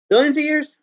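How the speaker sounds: high, mocking repeat of "Billions of years?"; mocking echo. Shown as a demonstration. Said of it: "he says".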